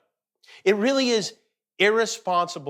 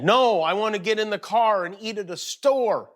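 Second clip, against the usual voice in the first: lightly mimicked kid outburst, pitched higher; higher, insistent mock-kid protest; imitating his son; 7 semitones higher than usual. Host quotes his fed-up son at dinner, mock protest.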